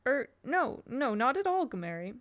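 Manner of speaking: confused. Acted. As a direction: stumble over the denial. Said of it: "Barney said, confused".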